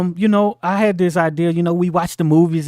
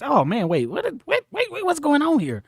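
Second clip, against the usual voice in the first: high, soft, rising alarm "wait, wait"; sudden realization; 10 semitones higher, 5 dB quieter than usual. Retold or imitated. Grown child realizing the truth about mother, high and alarmed. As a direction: squeak out the dawning shock. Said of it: "then you realize".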